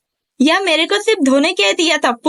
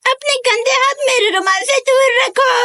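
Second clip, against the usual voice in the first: angry shout; 5 semitones higher than usual. Acted. Protecting her handkerchief.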